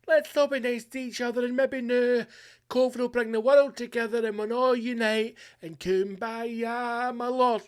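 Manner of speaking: mock the naive unity crowd, high and dreamy; mock naive hippie. Host mocking hopeful lockdown optimists, high sing-song voice. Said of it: "we're past the stage of".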